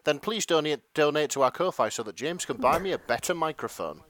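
ordinary delivery

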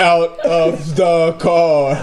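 slow and drawn out. Acted. Suspect delivering mugger line.

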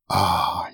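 low, soft breathy "Ah" after a drink; a quiet relieved exhale; breathed sigh of relief. A narrator's reading. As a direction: savour the liquor with a soft breath out. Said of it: "he breathed".

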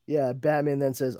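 usual speaking voice

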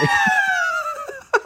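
big high laugh breaking out. Real, not acted. Laughing hard at the chick seller's line. Laughs after shouted quote.

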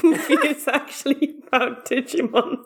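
high-pitched, talking through laughter; giggly, laughter breaking into the words; laughing. Real, not acted. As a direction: crack up while pitching the joke. Joking through laughter about the plot.

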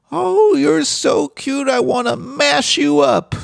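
imitating a grandma overwhelmed by how cute the child is; doting grandmother. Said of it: "she wouldn't sp-, say it angrily, but she'd be like".

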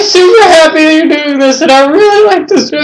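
high, loud gushing impression; gushing sweet-talker. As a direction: gush it sky-high like an overly eager woman. Acting out a gushing woman.

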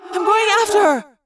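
high echoing shout; urgent yell. A narrator's reading. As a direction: call out your decision urgently. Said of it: "Cassie yelled".

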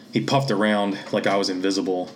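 usual speaking voice

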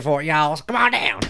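loud, hammed-up Southern accent rising high. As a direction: put on a folksy Southern holler. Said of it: "do a Southern Jack accent".

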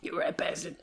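unvoiced whisper of the insult. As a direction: breathe it out like a secret. Repeating line again.

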